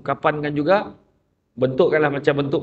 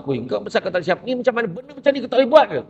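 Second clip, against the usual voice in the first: mock angry boss; 7 semitones higher than usual. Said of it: "Bos marah macam tu".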